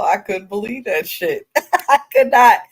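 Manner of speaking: incredulous, through laughter; real amused disbelief. Real, not acted. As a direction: break into a laugh while insisting. Laughs recalling own shocked reaction.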